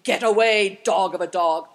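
loud, booming shout of abuse; angry thundering bellow. A narrator's reading. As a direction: drive the dog off with a roar. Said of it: "a thundering voice shouting".

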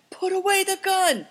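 high, loud, alarmed voice; panicked plea. A narrator's reading. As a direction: plead urgently at gunpoint. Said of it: "panted the man".

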